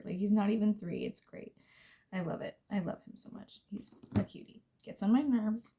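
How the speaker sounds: ordinary talk, nothing marked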